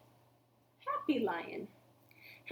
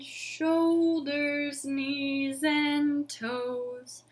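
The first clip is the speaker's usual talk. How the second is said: really slow; much slower than usual. Shown as a demonstration. Teacher leads the slow round for the children. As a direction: sing every word stretched out very slowly. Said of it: "Let's do it really slow this time".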